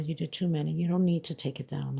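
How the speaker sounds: usual speaking voice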